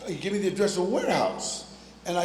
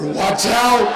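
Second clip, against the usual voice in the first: loud, raised mock alarm; shouted warning; 4 semitones higher, 9 dB louder than usual. Counselor warning the caller they are out there, shouted. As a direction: yell it as a sudden warning.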